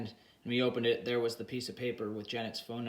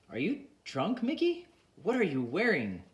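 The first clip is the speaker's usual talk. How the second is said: shocked; 6 semitones higher than usual. Acted. Janet shocked at bathrobe.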